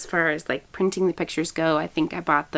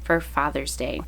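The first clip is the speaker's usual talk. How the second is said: ordinary talk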